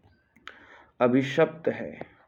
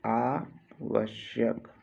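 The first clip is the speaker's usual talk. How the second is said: "आवश्यक" slow, pause after आ; split slowly for dictation; 4 dB quieter, much slower than usual. Shown as a demonstration. Teacher dictating a spelling, आवश्यक slowly in two parts. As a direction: break "आवश्यक" into आ, pause, वश्यक.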